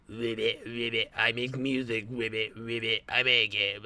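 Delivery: lightly croaked frog imitation. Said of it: "frog voicing".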